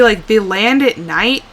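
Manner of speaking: ordinary delivery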